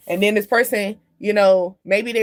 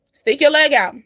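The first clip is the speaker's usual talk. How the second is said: louder, higher push on the phrase; emphatic urging; 5 semitones higher, 6 dB louder than usual. Shown as a demonstration. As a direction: urge it out loud like a hype coach. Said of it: "time for you to stick your leg out".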